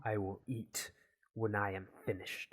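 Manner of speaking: measured scientist voice, held down; controlled stubborn refusal. Acted. Gavin refuses the Hot Pocket, controlled firmness. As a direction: refuse firmly without raising the voice.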